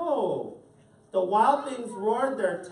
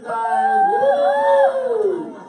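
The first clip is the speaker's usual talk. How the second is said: very high, loud squeal; ecstatic cheer; 11 dB louder than usual. Real, not acted. Reader cheering to the kids that Max got his supper. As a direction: shriek with joy. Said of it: "cheering that Max got food".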